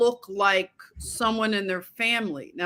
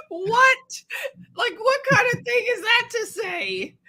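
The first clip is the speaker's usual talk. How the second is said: much higher, stunned disbelief; incredulous; 13 semitones higher than usual. Retold or imitated. Recalling a rancher's odd compliment, incredulous and pitched up high. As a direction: replay the moment you couldn't believe it.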